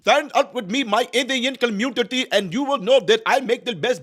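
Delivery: high, loud put-on Indian accent; mock Indian accent. Parody Kamala courting the Indian community in a mock Indian accent. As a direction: ham up an Indian accent at a rally. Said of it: "these different accents".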